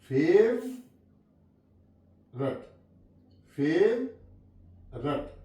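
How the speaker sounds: फेवरेट said slowly with no o, fev-rut; silent o. Shown as a demonstration. Teacher slowly models फेवरेट for students. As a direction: drag out फेवरेट as fev-ret, dropping the o. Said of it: "यहाँ पे ओ silent है".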